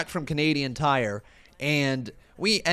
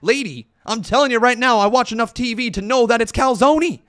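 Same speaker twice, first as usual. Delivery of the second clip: loud, higher, insisting he's right; mock heated insistence; 7 semitones higher, 7 dB louder than usual. Retelling his argument with Becca, loud mock insistence. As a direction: hammer the point home with rising outrage.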